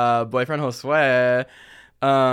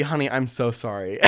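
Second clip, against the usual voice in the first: ordinary talk, nothing marked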